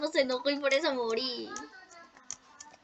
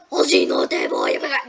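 much lower, loud mock threat; deep mock Mom voice; 7 dB louder than usual. Gamer recalls fighting Mom, booms a deep mock threat. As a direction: boom it out like a cartoon mom boss. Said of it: "cuando me enfrenté a Mom".

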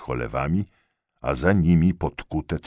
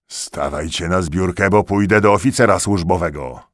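stern threat; 3 semitones higher, 7 dB louder than usual. A narrator's reading. Corporal threatening to fetch officer.

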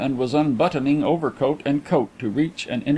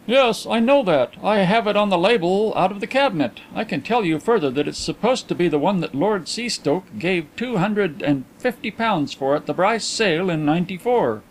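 a lightly raised, eager detective voice; the inquiry agent reintroducing himself, keen and higher; Mister Carlyle voiced; 6 semitones higher than usual. A narrator's reading. Detective greeting the coin dealer, lightly voiced higher.

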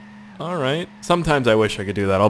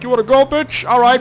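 taunting street racer; 12 semitones higher, 5 dB louder than usual. Retold or imitated.

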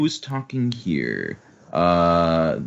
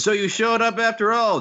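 high-pitched character call; hero's greeting; 12 semitones higher than usual. Acted. Luffy calling to his rival across the water, high hail. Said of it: "Luffy goes".

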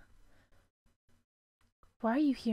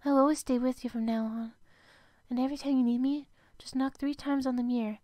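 ordinary talk

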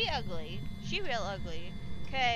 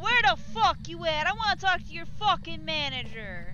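loud, higher taunting yell; shouting; 7 semitones higher, 7 dB louder than usual. Acted. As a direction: scream insults to provoke her. Yelling to provoke the ghost.

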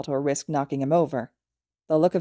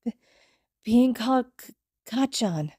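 put-on tearful child; 7 semitones higher than usual. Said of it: "tears began to fall".

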